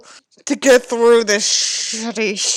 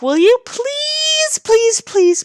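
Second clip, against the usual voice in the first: long, high, drawn-out begging please; exasperated pleading; 13 semitones higher, 6 dB louder, slower than usual. Real, not acted. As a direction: stretch each please out in disbelief. Fed up with the character.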